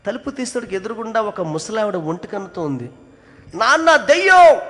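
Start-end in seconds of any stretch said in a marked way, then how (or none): 3.5-4.6 high, loud, slow shriek of fright; little girl screaming at a ghost at the door; frightened scream. Retold or imitated. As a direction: scream for your dad in terror. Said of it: "అని గట్టిగా అరిచింది".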